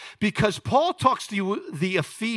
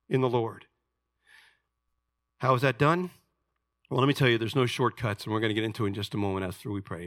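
ordinary talk

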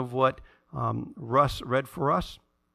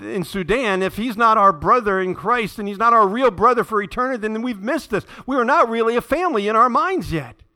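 loud, higher, urgent preaching; impassioned preaching; 5 semitones higher, 5 dB louder than usual. Acted. Pastor challenging the congregation about the boy in Sudan, loud and urgent. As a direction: drive the point home with force.

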